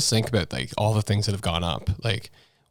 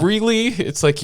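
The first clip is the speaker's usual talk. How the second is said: incredulous; 11 semitones higher, 3 dB louder than usual. Real, not acted. Disbelief at Trudeau's claim.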